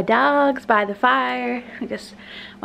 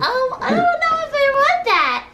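high, squeaky voice for the dog with a laugh; dog's voice; 13 semitones higher than usual. Pretend. Owner voicing her dog's reluctance, high and squeaky. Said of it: "She's like".

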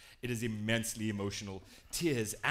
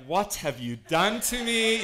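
slow, loud, higher-pitched mock dismay; appalled friend; 8 semitones higher, 9 dB louder, slower than usual. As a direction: imitate an aghast friend, drawing it out loudly. Acting a mortified friend.

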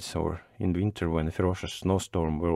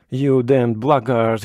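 slow, louder angry curse; angry shout; 3 semitones higher, 6 dB louder, slower than usual. A narrator's reading. Postman runs up to the driver, shouting in fury. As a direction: curse him in a raised, furious voice. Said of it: "he shouted".

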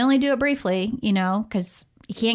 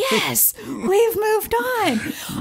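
high, very slow, gloating; mock triumphant; 11 semitones higher, much slower than usual. Mom mocking her own victory over toddler, drawn-out gloat. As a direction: savor the win over the toddler. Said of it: "So I'm like".

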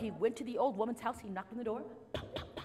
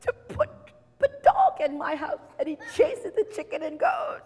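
high, shouted, building tirade; exasperated rant; 13 semitones higher, 15 dB louder than usual. Acted. Man blames old woman for the noisy animals, shouting. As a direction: shout the grievance, stretching key words.

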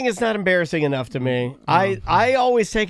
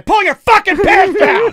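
high, drawn-out furious yell; angry shouted order; 12 semitones higher, 8 dB louder, slower than usual. Pretend. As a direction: bellow it slowly like a gunman losing patience. Acted gunpoint order, repeated angrily.

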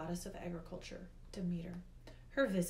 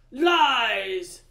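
very loud, higher outburst; furious shout; 4 semitones higher, 18 dB louder than usual. A narrator's reading. Hades answers the accusation of theft, furious shout. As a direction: roar out the denial.

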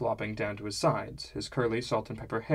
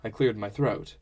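ordinary delivery, nothing marked

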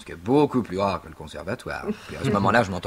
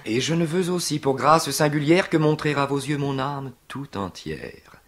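Tartuffe; 7 semitones higher, 3 dB louder than usual. Acted. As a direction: pour out a stage lover's ardour. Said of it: "je rêve de jouer Tartuffe".

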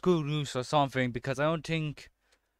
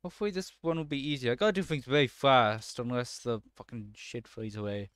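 ordinary talk, nothing marked

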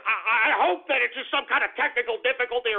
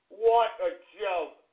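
normal talking voice

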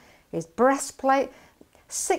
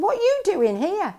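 higher, louder scolding that cools at the end; angry big brother; 5 semitones higher, 6 dB louder than usual. Retold or imitated. Eldest brother telling David off, angry and higher. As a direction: scold the little brother, hot then settling. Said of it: "is so angry with David".

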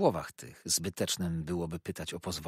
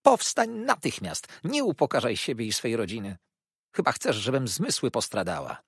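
mother commanding daughter off her knees; thundering command; 4 semitones higher, 6 dB louder than usual. Acted. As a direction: order her up, outraged. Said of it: "zawołała grzmiąco".

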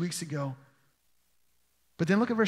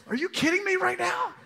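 much higher, laughing through it; laughing disbelief; 11 semitones higher than usual. Retold or imitated. Students as the teacher hunts the singer, high laughing disbelief. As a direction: squeak it out in amused disbelief. Teacher walks aisles hunting culprit.

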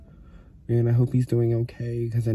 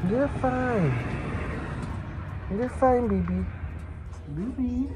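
soothing a scared kitten; 12 semitones higher, 8 dB quieter than usual. Real, not acted. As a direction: coo gently to calm him. Comforting her scared kitten at vet.

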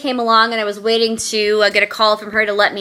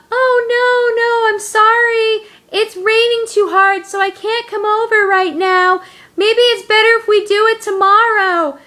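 mock apology in a very high sing-song; phony apology; 12 semitones higher, 3 dB louder than usual. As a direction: imitate a friend faking regret, pitched way up. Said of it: "she gave me a very phony hello".

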